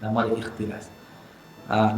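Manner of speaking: usual speaking voice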